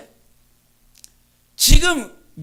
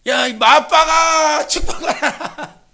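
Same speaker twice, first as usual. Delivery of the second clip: demanding call; 4 semitones higher, 6 dB louder, much slower than usual. Retold or imitated. Quoting the man's request.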